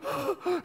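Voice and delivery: high, sharp gasp for air; out-of-breath gasp. Retold or imitated. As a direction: gasp like a winded runner. Out-of-shape Peter reaches tomb.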